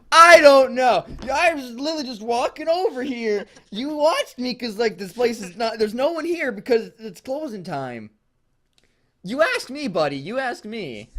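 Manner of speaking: high, loud indignant protest; pushing back hard at the accusing employee. Acted. Betty defends herself indignantly.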